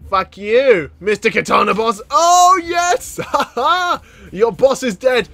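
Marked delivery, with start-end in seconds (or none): 2.1-5.3 high, loud victory cry; gleeful high yell of triumph; triumphant. Real, not acted. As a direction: celebrate the kill. Killed the boss.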